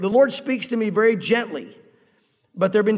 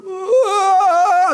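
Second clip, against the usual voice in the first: put-on high frightened wail of a startled child; scared kid; 11 semitones higher, 7 dB louder than usual. Startled child shrinking from a loud man, frightened high wail. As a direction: let out a frightened little cry. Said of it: "he just stopped and started".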